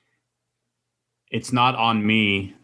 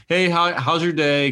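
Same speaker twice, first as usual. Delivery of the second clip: bright, higher cashier voice, a little louder; cashier greeting; 7 semitones higher, 5 dB louder than usual. Retold or imitated. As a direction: play the routine cashier hello. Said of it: "the cashier lady".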